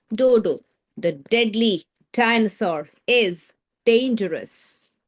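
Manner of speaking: Dodo the deadly dinosaur is dangerous, slow, every D hit hard; slow stressed D sounds. Shown as a demonstration. Teacher repeats for class, slow and stressed. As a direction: spell it out slowly, punching each D. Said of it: "What are you noticing?".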